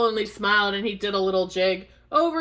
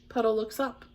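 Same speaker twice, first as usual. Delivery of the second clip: usual speaking voice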